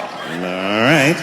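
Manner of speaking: low, dragging police veteran taking a report; weary police officer. Acted. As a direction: play a tired cop who doesn't buy the story. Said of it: "police veteran taking this kid's police report".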